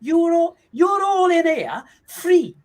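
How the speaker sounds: hammed-up Russian accent, very high and drawn out. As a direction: squeal out the Russian accent in comic confusion. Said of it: "one of your wonderful accents".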